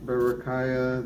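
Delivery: normal talking voice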